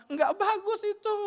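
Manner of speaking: high, loud, slow reproach; scolding mother. Retold or imitated. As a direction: imitate mom's exasperated high-pitched verdict. Mother scolds child over report. (ibu mengomel, nada tinggi)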